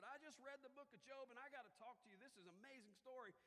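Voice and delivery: high, loud gushing, building; excited caller. Retold or imitated. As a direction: gush about an amazing discovery, imitating the caller. Retells an enthusiastic member's call.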